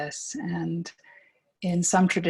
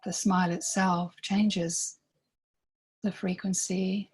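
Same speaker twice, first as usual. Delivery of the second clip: guided meditation. Real, not acted. Guide inviting listeners to breathe into themselves, calm meditation voice. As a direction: lead them gently inward, leaving space between phrases.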